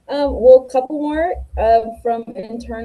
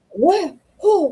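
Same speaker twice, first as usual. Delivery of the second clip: surprised; 6 semitones higher than usual. Real, not acted. Reacting to a surprise.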